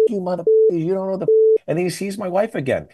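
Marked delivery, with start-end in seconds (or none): 0.1-1.3 fast, higher-pitched imitation of an angry rant; mock yelling. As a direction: imitate a man cursing out the cops, without real volume. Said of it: "he's yelling at the cops".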